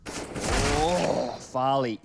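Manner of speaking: loud excited shout of the name; excited call. Real, not acted. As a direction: burst out with the player's name. Caster reacts to kill.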